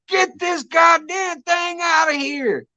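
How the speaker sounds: high, slow mock scream of a mother; imitating mom screaming. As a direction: shriek it like a panicked mom. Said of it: "she's screaming".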